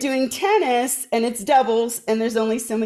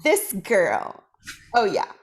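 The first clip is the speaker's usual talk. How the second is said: ordinary talk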